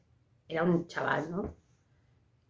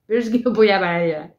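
louder, faster crying-through-it protest; imitating tearful protest; 3 semitones higher, 7 dB louder, faster than usual. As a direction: blurt it out while still weeping. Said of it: "y yo empecé ahí a llorar".

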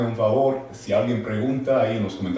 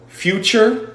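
slow, high rising future; 11 semitones higher than usual. Shown as a demonstration. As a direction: lift future up slowly. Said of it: "future, present, past". (futuro con tono que sube)

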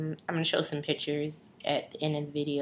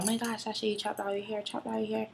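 a lightly higher, softer mock gasp; mock shocked onlookers; 5 semitones higher, 5 dB quieter, faster than usual. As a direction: imitate people gawking at the haircut. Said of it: "Everybody's like".

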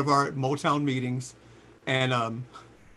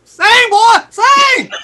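much higher and louder whooping shout; shouting encouragement; 17 dB louder, much slower than usual. Retold or imitated. Cheering [name] on while listening, a laughing shout. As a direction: cheer the singer on at full voice. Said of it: "I was like".

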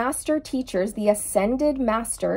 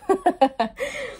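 bright rising chuckle; quick high laugh; high amused laugh; 10 semitones higher than usual. Real, not acted. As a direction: laugh at your own joke. Laughing after naked joke.

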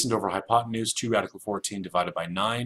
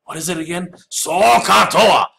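higher, loud stretched out cry on Sokatoa; student screaming; 11 semitones higher, 13 dB louder than usual. Pretend. Student in class screams the mnemonic: loud war cry. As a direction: call it out like a war cry to remember. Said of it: "scream out whenever you need to".

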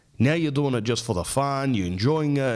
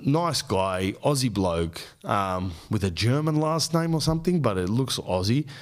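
normal talking voice

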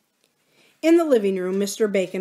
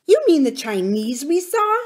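shocked; 7 semitones higher, 5 dB louder than usual. Acted. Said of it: "Missus Bacon was shocked".

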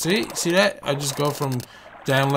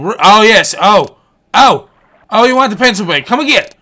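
loud, high whoops of triumph; thrilled, punched-out yells; excited shouting; 5 semitones higher, 10 dB louder than usual. Real, not acted. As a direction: cheer the fish on loudly. Fish finally goes for bait.

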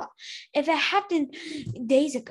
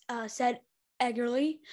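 plain reading voice

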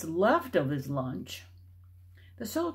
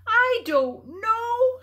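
much higher, louder, slow and choked; choked crying; 9 dB louder, much slower than usual. A narrator's reading. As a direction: sob it out. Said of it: "her voice choked".

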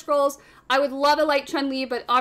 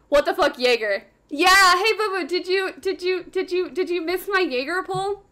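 ordinary talk

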